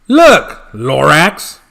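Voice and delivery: loud defensive call; loud protest. Acted. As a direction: talk him down loudly. Once-ler defends himself.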